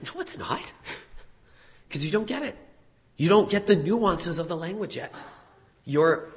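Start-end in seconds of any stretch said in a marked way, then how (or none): 0.0-0.7 squeaky high, quiet, confused learner; a puzzled student answers innocently; naive high reply. Retold or imitated. Learner at a meal answers the host, high and soft. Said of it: "You might be like".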